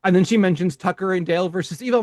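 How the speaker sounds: ordinary talk, nothing marked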